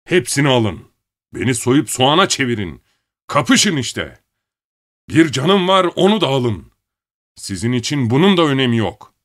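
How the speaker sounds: furious shout at full voice. Acted. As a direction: bellow it out in rage. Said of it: "Avazı çıktığı kadar bağırarak".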